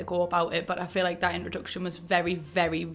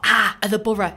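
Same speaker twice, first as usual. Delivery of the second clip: hopeful exclaim; 7 dB louder than usual. Retold or imitated. Hoping fans were Boro.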